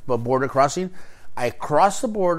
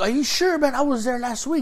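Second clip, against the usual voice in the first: incredulous question; 10 semitones higher than usual. Retold or imitated. Disbelieving the border officer.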